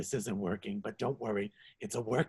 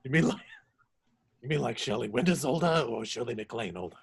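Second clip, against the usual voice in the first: surprised; 7 dB louder than usual. Acted. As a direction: gasp out the question. Shocked at going older.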